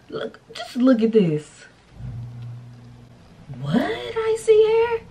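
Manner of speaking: rising to a high, slow, drawn out Icy hair; amazed. Real, not acted. Showing off the styled wig, high amazed squeal. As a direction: squeal in disbelief, stretching it.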